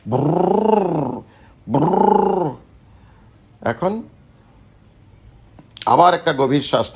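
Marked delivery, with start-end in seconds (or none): 0.1-2.5 long buzzing lip trill, pitch rising then falling; raised-pitch motorboat lip roll; lip trill demo. Shown as a demonstration. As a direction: blow a sustained brrr through loose lips. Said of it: "lip ট্রিল করে দেখেন".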